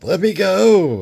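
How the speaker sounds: high, loud, slow cry to be freed; desperate plea. Retold or imitated. Captured spy pleading, high loud cry. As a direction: struggle and yell. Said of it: "Montana says".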